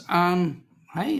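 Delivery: normal talking voice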